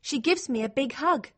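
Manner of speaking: ordinary delivery, nothing marked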